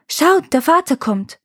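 put-on a child shouting news from the window; calling out. A narrator's reading. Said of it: "schaute eins der Kinder ... und rief".

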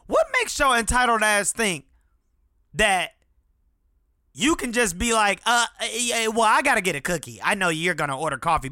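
mock furious rant at a stranger; irritated inner rant. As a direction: let the annoyance build in your head. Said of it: "'cause I'm like".

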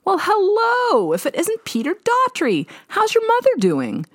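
high, bright nasal twang of a chirpy mother; cheerful mom voice. A narrator's reading. Mom greeting her daughter's old boyfriend, high and cheerful. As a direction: sing it out like a delighted small-town mom. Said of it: "Her cheerful voice broke through".

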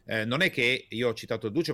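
ordinary talk, nothing marked

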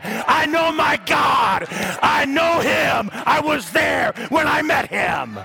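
high, shouted revival preaching; pulpit-pounding sermon voice; fiery preaching. Acted. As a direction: preach it out full force to the church. Preaching at full force.

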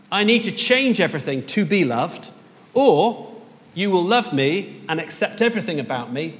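ordinary delivery

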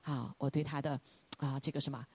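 ordinary talk, nothing marked

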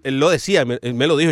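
ordinary talk

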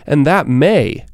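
"may" drawn out slowly, then a pause; stress on MAY. Real, not acted. Adviser qualifying a promise to a client, stress on "may". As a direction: lean on "may" and let it hang. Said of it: "emphasis on may here".

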